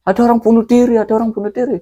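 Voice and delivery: high, breathless repeated alarm; urgent answer. Retold or imitated. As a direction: insist in fright. Witness answers villagers urgently. (seruan panik dengan suara tinggi)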